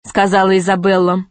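ordinary delivery, nothing marked

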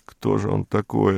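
plain reading voice

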